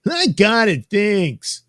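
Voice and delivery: high, loud put-on voice; high character retort. Cutting Hush off in the comic, high loud retort. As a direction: pitch the retort way up.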